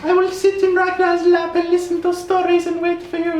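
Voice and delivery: very high, laughing put-on voice; mock serene character. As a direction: parody a saintly farewell in a squeaky voice. Mocking a dying character's serenity.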